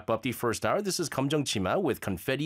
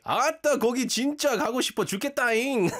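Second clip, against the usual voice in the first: high, very fast Jeolla dialect breaking into laughter; Jeolla accent, laughing; 11 semitones higher, much faster than usual. Retold or imitated. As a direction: Jeolla accent, giggling through it. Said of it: "He's like".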